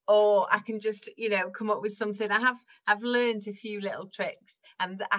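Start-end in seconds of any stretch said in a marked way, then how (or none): none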